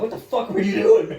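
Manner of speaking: loud, high retold outburst; mad. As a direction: act out being mad at him, half laughing. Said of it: "I was mad at him".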